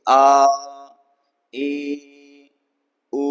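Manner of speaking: prolated vowels आ, ई, उ drawn out very long; extra-long vowels. Shown as a demonstration. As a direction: hold each vowel out long, like Vedic chant. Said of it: "इस प्रकार से इनका उच्चारण होता है".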